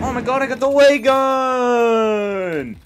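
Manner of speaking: high, long drawn-out cry of triumph; ecstatic cheer. Real, not acted. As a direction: stretch the words out in elated celebration. Wins the ray gun.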